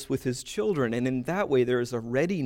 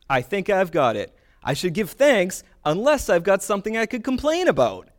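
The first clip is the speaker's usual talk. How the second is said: loud, high, hammed-up wrong conclusion; confused questioner; 6 semitones higher, 5 dB louder than usual. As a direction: confidently get it wrong, playing it big. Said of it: "you're confused".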